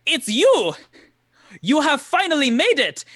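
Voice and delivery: loud, high-pitched burst of joyful recognition; thrilled greeting. Acted. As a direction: exclaim it as the excited camp man, high and loud. Character greets awaited travellers with joy.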